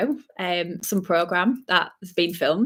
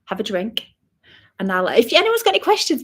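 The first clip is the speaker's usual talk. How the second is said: usual speaking voice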